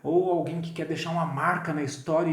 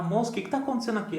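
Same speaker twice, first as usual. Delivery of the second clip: fast, higher urgent question; hurried question; 7 semitones higher, much faster than usual. Retold or imitated. Survivor asks a stranger what is happening, fast. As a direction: blurt it out in alarm. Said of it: "Você chega lá".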